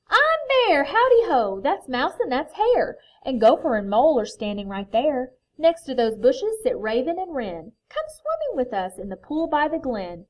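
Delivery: loud, welcoming storybook voice; hearty introduction. A narrator's reading. Bear introducing friends to the stranger, hearty welcome. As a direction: open high and loud, introducing everyone.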